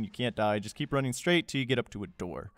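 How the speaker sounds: normal talking voice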